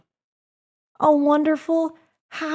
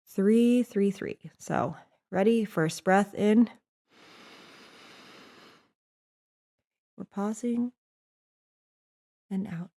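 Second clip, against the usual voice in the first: guided breathing; 7 semitones lower, 8 dB quieter than usual. Acted. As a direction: guide them gently into the breath. Leads a breathing exercise.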